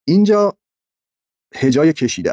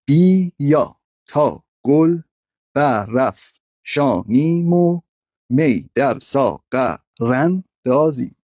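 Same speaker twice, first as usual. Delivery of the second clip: very slow, each syllable set apart; much slower than usual. Shown as a demonstration. Teacher splitting a Hafez verse for scansion, syllable by syllable. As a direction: break the verse into separate, drawn-out syllables.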